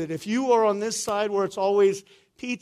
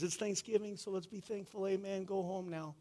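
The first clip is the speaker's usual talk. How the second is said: mock trite pastor; 2 semitones higher, 11 dB quieter than usual. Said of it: "the trite pastor that shows up and says".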